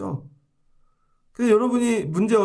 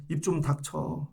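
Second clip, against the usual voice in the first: hushed blunt order; 2 semitones lower, 8 dB quieter, slower than usual. Pretend. God's blunt word retold.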